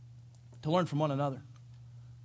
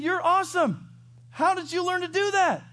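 much higher and louder, gushing; excited praise; 7 dB louder than usual. Retold or imitated. Proud father gushing at his son's bike trick, high and loud. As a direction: gush over the kid like a proud dad. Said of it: "I said".

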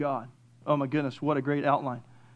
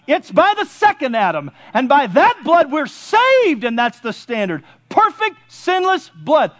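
high, emphatic sermon delivery; full-force preaching; 7 semitones higher, 7 dB louder, slower than usual. Acted. Preacher telling church God is not angry, full-force preaching. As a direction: drive each phrase home from the pulpit.

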